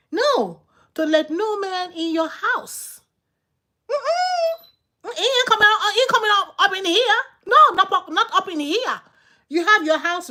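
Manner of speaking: loud, high mock-outraged no; emphatic high-pitched warning. Real, not acted. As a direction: rise up high and refuse him outright, laughing.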